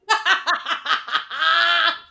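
a long, high laugh; high delighted laugh. Real, not acted. Laughing at the joke name Braunschweiger for the child.

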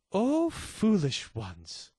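solemn archaic phrasing. Shown as a demonstration. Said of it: "That sounds nice when you say it like that".

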